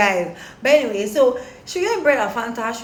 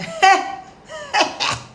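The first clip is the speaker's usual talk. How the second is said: high laughing giggle; real giggle; 12 semitones higher than usual. Real, not acted. Amused by story.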